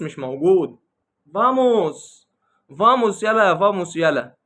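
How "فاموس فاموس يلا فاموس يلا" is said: high, punchy "vamos" calls; mock someone forever shouting vamos; mimicked rallying cry. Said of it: "هو كل مشكلته ان هو بيقول فاموس". (تقليد ساخر لصيحة فاموس بنبرة عالية)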